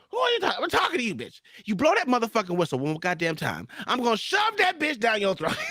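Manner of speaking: high, shouted threat, raging; threatening the whistle-blowing attendant, shouting; angry threat. Retold or imitated. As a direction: yell it right in her face. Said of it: "I was just angry".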